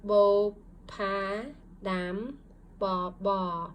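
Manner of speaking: one syllable at a time, with pauses; syllables read out. Shown as a demonstration. Teacher reading syllables together with the class, each one apart. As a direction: split each syllable cleanly. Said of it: "let's read it together".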